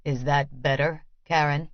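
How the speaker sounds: a lightly voiced stern master; flat, accentless Vasily. A narrator's reading. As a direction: drop the accent, keep it level and controlled. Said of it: "His voice is different ... replaced by a flat tone".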